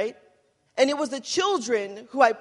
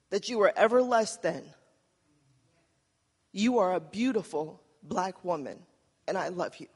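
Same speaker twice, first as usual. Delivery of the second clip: mother's tender words; 4 semitones lower than usual. Retold or imitated. Korean mother telling six-year-old Angie she is beautiful, tender. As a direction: say it like a mother promising her child.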